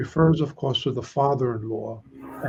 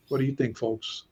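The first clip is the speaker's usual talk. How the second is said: ordinary talk, nothing marked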